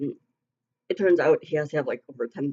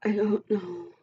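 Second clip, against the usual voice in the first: crying; 4 semitones higher, 4 dB quieter, slower than usual. Real, not acted. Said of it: "I'm crying right now".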